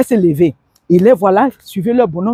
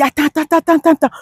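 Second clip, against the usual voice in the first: pleading husband; 7 semitones higher, much faster than usual. Retold or imitated.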